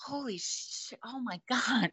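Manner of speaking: high, hushed disbelief; soft, high-pitched awe; amazed. Retold or imitated. Reliving amazement at working show.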